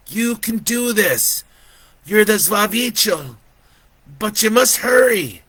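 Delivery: put-on high, loud, urgent child; insistent high-pitched boy; little boy's voice. A narrator's reading. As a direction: urge him on as a small boy. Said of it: "that little boy's voice".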